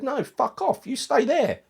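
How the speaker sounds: high, firm mock order; imagining a strong manager telling Rice to stay put. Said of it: "a strong manager would have said".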